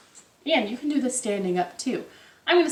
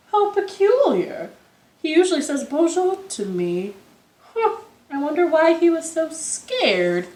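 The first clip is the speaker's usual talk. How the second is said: higher, wondering lion voice; puzzled lion; 7 semitones higher, 4 dB louder than usual. Acted. Lion wondering why his friend fled, raised puzzled voice. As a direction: muse aloud, baffled.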